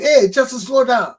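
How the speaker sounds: high, loud command to halt; imitated boss yelling to stop; boss cutting in. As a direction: call out sharply to slow someone down. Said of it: "My boss will say".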